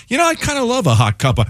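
put-on high, fast, hopeful drunk voice; cartoon drunk guy. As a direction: play the sailor-drunk who thinks he's getting coffee. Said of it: "beyond cartoon sailor drunk guy".

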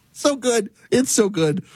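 high-pitched through laughter; real giddy, high laughing voice; tickled laughter. Real, not acted. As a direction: gasp it out while cracking up. Laughing at his own retelling.

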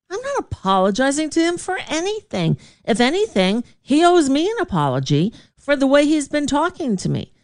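high, defiant retold comeback; indignant refusal. Refusing the general manager's demand to apologize, high and defiant. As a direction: toss it back high and unbending. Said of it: "I'm like".

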